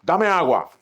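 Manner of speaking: a rude demand for water. Shown as a demonstration. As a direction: bark the request. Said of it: "¿Y cómo uno pide agua?".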